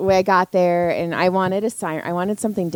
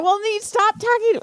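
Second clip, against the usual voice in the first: high teasing; 14 semitones higher than usual. Real, not acted. Teasing banter.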